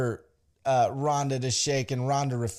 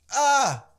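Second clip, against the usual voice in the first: short, high, loud "ah"; imitated crowd gasp; 11 semitones higher, 5 dB louder than usual. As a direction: cry out like a stunned audience. Imitates crowd reacting to the line.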